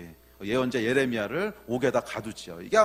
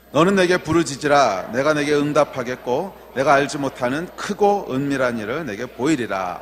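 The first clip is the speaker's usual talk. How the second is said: loud, declaimed Bible verse; scripture reading; 9 dB louder than usual. Acted. Preacher leads congregation in reading the verse, loud and declaimed. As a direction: read the verse out firmly and loudly.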